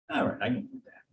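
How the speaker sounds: soft whispered okay; whispered acceptance. Retold or imitated. Employee accepting triple pay from the boss, whispered. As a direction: whisper it, appeased.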